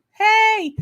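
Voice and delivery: high, loud hailing "hey"; excited greeting. Real, not acted. Host spotting Graham in the chat, excited greeting. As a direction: call out a drawn-out high hello.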